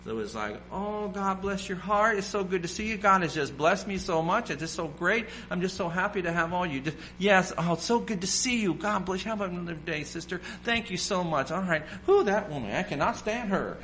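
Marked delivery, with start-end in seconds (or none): 0.7-6.8 higher, sugary fake-cheerful church greeting; phony churchgoer. Preacher mocking fake-happy churchgoers, higher sugary gushing. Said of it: "that kind of phoniness". 7.2-13.8 higher, sugary fake-cheerful church greeting; phony churchgoer. Preacher mocking fake-happy churchgoers, higher sugary gushing. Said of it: "that kind of phoniness".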